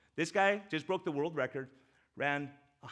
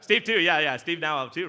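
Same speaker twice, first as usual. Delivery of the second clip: loud agreement; 3 semitones higher, 12 dB louder than usual. Real, not acted. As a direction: call it back loudly to the audience. Answers a shout from the audience.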